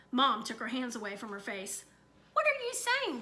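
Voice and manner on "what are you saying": a high, alarmed question. Acted. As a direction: mom suddenly alarmed. Mother alarmed by news.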